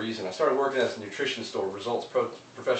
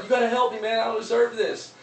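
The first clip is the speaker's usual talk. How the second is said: much higher pleading voice; desperate plea; 12 semitones higher, 3 dB louder than usual. Retold or imitated. Man in hole pleads with passing preacher, high desperate voice. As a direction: beg the preacher to help. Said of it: "the guy's like".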